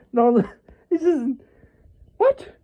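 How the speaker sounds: halting, very high incredulous squeak; hysterical reaction. Acted. Imagined elf cannot accept the outcome, hysterical disbelief. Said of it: "hysterical reaction of being like".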